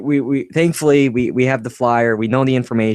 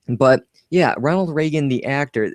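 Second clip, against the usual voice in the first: ordinary talk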